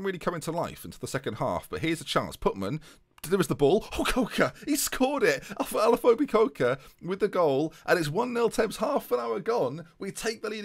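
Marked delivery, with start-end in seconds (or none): 3.2-10.6 high, loud burst of celebration; excited goal commentary. Real, not acted. Commentator calling Coker's goal for Thames, excited and high. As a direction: call the goal as it goes in, voice leaping up. Live call of a goal scored.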